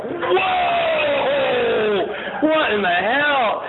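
high drawn-out "whoa", then slow disbelief; hammed-up horror, stretched out and high; mock shocked yell. New father watching the birth, comic shock.

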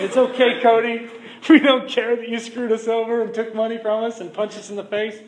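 mock their fake forgiveness with a laugh. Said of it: "not everybody said".

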